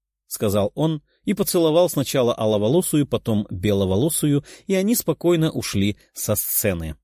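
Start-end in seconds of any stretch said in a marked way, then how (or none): none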